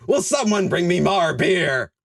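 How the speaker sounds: high, drawn-out dwarf plea; crying out. A narrator's reading. Squat lord begging someone for beer, high cry. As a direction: call out slowly, pitched well up. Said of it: "he cried".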